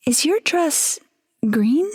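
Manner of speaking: high, wondering question; curious wife. Acted. Wife squinting at the ghost's dress, high wondering. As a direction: lift it in disbelief.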